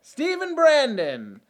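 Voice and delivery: high, loud, drawn-out name announcement; hyped-up introduction. Acted. Host introducing the guest to listeners, hyped-up announcement. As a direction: sing out the guest's name like a big reveal.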